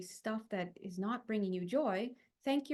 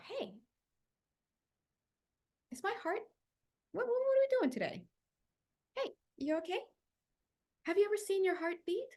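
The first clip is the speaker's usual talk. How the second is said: sweet inner voice; 10 semitones higher than usual. Pretend. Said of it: "start asking yourself".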